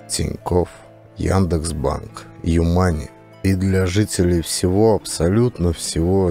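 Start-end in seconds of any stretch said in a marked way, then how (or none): none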